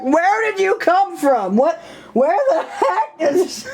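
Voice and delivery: slow hammed-up confusion; mock startled. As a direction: act ambushed out of nowhere. Startled self by wave.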